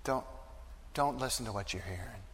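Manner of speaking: tender soft comfort; gentle reassurance. Acted. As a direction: arm around a grieving father, speak softly. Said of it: "put his arm around us and say".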